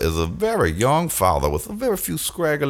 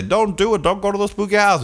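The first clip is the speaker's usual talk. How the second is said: high mock pleading; 10 semitones higher than usual. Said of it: "And they all say".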